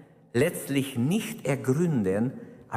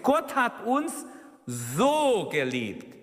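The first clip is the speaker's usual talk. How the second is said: "so" slow and drawn out; stress on so; 6 semitones higher, slower than usual. Shown as a demonstration. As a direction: lean hard on "so" in "Gott hat uns so geliebt". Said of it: "die Betonung liegt auf so im Griechisch". (Betonung auf "so", langsam gedehnt)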